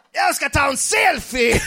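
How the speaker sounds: high, drawn-out mock shout; slow, high-pitched intruder's holler; girl barging in. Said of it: "kommer en tjej in bara".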